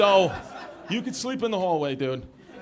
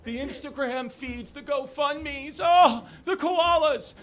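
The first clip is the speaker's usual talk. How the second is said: higher, slow, hammed-up mourning over the koalas; mock somber grief; 7 semitones higher, 4 dB louder, much slower than usual. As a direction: wail it like a melodramatic news feed. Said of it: "they made it so somber".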